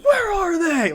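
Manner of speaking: imitated scream, loud and high, as a masked vigilante; Batman. Interrogating Joker in a retold scene, screamed Batman imitation. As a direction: yell it like a superhero interrogating a villain. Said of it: "throwing around Joker and screaming".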